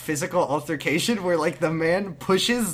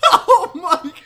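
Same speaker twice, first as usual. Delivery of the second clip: laughing disbelief; 5 semitones higher, 4 dB louder than usual. Real, not acted. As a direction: drag it out through laughter.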